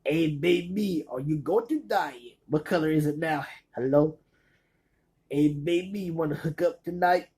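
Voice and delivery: slightly hammed-up come-on, imitating a comedian; Cheech. Cheech trying to get laid, lightly imitated come-on. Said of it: "Cheech is trying to get laid".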